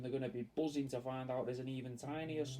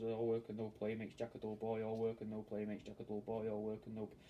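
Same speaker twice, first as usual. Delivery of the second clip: lower, flat repeating drone; quoting The Shining; 6 semitones lower, 4 dB quieter than usual. Retold or imitated. Quoting Jack from The Shining to a mate, in a lower, mechanical drone. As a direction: drone the line over and over like a man coming unhinged. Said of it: "where he says".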